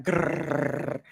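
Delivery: long rolled playful growl; dog growl imitation.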